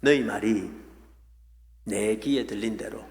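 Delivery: low, grave voice of God. Retold or imitated. God answering the grumbling people, grave and low. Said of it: "하신 말씀이 이 말씀이에요".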